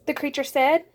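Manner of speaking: ordinary delivery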